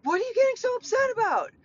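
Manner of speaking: high-pitched, quick imitation of an indignant question; Michael's mock protest. Stepdad asking why she's upset, high mock protest. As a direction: throw it back in a squeaky-high mocking voice. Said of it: "then he's like".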